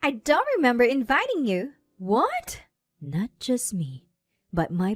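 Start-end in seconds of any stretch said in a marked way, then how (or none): 0.0-1.7 high, loud, affronted snub; haughty challenge. A narrator's reading. Bride confronts her sister at the wedding, haughty. 2.0-2.6 high, rising disbelief; stunned. A narrator's reading. Sister learns she was not invited, stunned.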